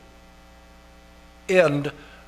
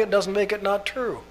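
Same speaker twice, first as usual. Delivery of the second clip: usual speaking voice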